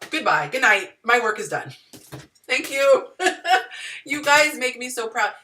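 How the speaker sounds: loud, high, thrilled goodbye; excited. Real, not acted. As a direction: cheer it out, overjoyed. Joyful mock farewell, laughing.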